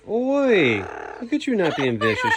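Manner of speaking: high sing-song cooing. Real, not acted. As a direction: coo at the bird playfully. Cooing to a parrot.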